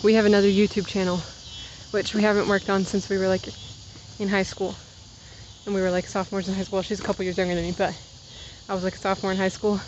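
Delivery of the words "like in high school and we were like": ordinary delivery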